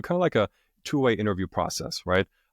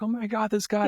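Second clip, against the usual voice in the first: high-pitched, imitating a guy cracking up; mock amused laughing; 13 semitones higher than usual. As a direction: retell it in a high, tickled voice. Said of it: "the guy starts laughing his ass off".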